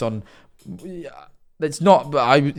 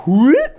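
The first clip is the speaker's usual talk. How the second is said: mock whoop; 10 semitones higher, 5 dB louder than usual.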